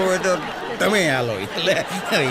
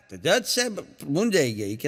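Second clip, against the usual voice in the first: usual speaking voice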